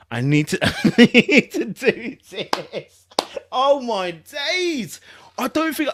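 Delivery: slow, laughing through it; can't keep a straight face; real giggles breaking into the words; laughing while trying to be serious. Real, not acted.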